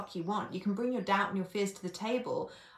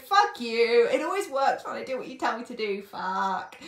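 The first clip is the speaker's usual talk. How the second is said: mock exasperated client; 3 semitones higher, 7 dB louder than usual. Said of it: "he was like".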